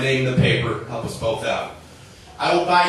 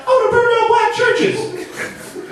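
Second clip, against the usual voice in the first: put-on much higher, slow little kid voice; squeaky child voice; much slower than usual. A little boy asked about his dream job, high slow kid voice. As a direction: say it like a sweet small child. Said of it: "It's like".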